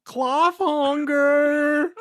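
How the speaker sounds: high, slow, loud villain name reveal; dramatic drawn-out shout. Acted. Cohost reading the fic's villain name, slow dramatic shout. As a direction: stretch the name out in a huge theatrical howl.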